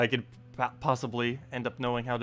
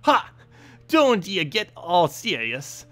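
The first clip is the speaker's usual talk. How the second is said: high, loud, drawn-out dwarf voice; hearty dwarf; 8 semitones higher, 6 dB louder, much slower than usual. Acted. Dwarf introducing his sister, hearty and loud. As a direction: call out as a proud dwarf, slowly. Reads dwarf character's dialogue.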